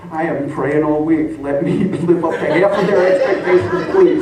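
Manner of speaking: laughing mock prayer. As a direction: pray it half laughing. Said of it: "And I'm thinking".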